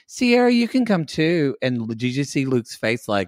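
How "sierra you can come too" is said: high, offhand mock invitation; flippant. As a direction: toss it off flippantly, pitched high. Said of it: "she just kind of flippantly said".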